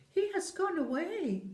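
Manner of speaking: put-on children telling the giant the boy left; children's voice. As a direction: chirp it like a small child.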